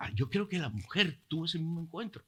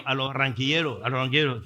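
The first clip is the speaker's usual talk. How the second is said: ordinary delivery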